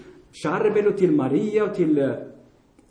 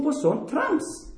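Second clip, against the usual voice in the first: slow, higher, dismissive drag; scornful; 8 semitones higher, 4 dB quieter, much slower than usual. Real, not acted. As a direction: drag out the contempt. Scorning popular belief.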